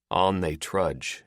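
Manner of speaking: usual speaking voice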